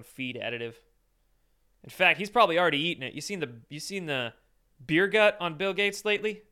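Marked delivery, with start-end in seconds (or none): none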